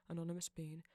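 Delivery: ordinary delivery, nothing marked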